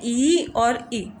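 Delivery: ई और ई drawn out very slowly; slow vowel demo. Shown as a demonstration. Teacher modelling two close vowels, very slow. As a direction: hold each ई long and slow. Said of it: "इसमें हमारे दो vowel आते हैं".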